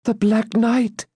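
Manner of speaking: high, slow, stricken voice; faint answer. A narrator's reading. Maiden names the fallen champion, faint. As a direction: let it out slowly, high and faint with dismay. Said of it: "answered Rebecca faintly".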